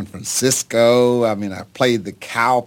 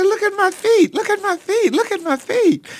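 much higher, pleading with his father to look at his hands; astonished cry. Retold or imitated. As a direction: cry it out in awe, pitched way up. Young man shows his father his feet.

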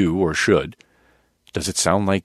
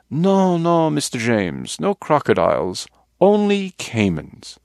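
put-on Colombian accent; 6 semitones higher, slower than usual. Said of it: "from Colombia".

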